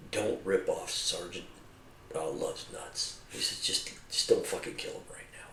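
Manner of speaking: very quiet, low, slow retold voice; low-key commander. Commander leaning on his Humvee to sergeant, hushed low-key. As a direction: say it under your breath, unhurried. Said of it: "He's like".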